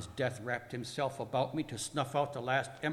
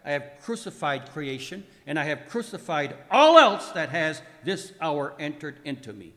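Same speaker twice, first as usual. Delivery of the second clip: litany building steadily to a loud peak; swelling dramatic proclamation; triumphant declaration; 3 semitones higher, 9 dB louder than usual. Acted. Acted list of victories, building.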